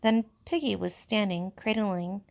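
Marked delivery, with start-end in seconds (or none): none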